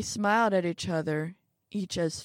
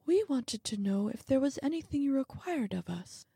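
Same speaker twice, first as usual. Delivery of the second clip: little man's voice; 7 semitones higher than usual. Acted. Little man asks the old man.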